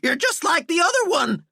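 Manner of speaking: high frantic yell; panicked yell. Acted. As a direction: yell it accusingly. Terrified Walter accuses.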